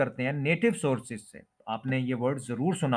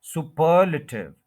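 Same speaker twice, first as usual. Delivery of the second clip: Superlative as su-PER-luh-tiv; superlative with heavy second syllable, quick weak luh; stress on second syllable; 3 semitones higher, 6 dB louder, slower than usual. Shown as a demonstration. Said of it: "second syllable पे आपने stress".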